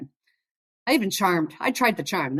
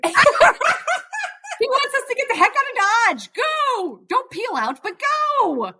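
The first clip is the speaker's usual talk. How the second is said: much higher, slow, sweetly urgent voice; sweet friend voice; 3 dB louder, much slower than usual. Retold or imitated. Friend urging a quick getaway, high sweet voice. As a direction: play the sweet friend shooing us off, high and drawn out. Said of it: "she was just so sweet and just said".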